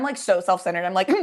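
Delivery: ordinary delivery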